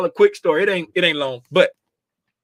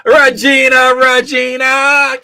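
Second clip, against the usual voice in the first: calling out; 8 semitones higher, 9 dB louder, slower than usual. Real, not acted. Calling out to viewer.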